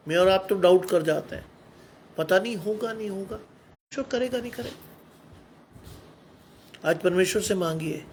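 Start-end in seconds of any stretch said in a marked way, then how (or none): 2.2-4.9 acting out a doubter, very soft; doubtful murmur. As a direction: trail away quietly, as if unsure God will act. Voices the doubters' inner wavering. (संदेह में डूबा, बहुत धीमा स्वर)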